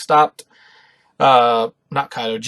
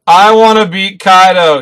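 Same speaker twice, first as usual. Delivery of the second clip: louder, slow tearful cry of vengeance; scream in tears; 4 semitones higher, 9 dB louder, slower than usual. Retold or imitated. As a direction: shout it through tears as a child. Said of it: "he screamed out loud in tears".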